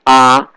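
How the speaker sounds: A held level; first tone. Shown as a demonstration. Teacher explaining the first tone, flat A. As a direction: keep A on one flat, sustained pitch. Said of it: "The first tone is flat".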